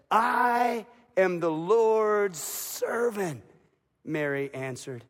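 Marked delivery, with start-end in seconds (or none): 0.1-3.4 very slow, weighty declaration; solemn surrender. Acted. Mary answering the angel, very slow solemn vow. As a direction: lay each word down slowly, with resolve.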